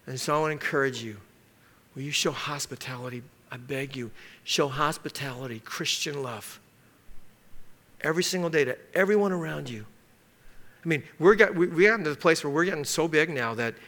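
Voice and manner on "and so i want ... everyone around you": soft, low, heartfelt appeal; gently imploring the congregation; earnest plea. Real, not acted. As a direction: drop low and quiet to plead with them. Pastor pleads with his congregation.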